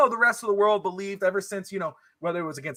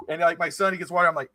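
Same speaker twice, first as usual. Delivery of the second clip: usual speaking voice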